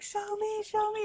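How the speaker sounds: put-on high, loud begging children; eager kids. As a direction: plead like excited siblings. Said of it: "they were like".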